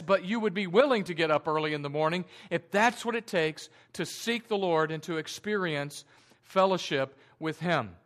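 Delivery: normal talking voice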